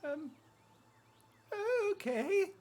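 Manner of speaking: very high, wary squeak; high-pitched, tentative animal voice; hesitant little creature. Acted. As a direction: agree reluctantly in a tiny critter voice. Creature hesitantly agrees to approach.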